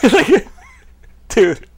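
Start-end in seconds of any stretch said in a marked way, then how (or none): none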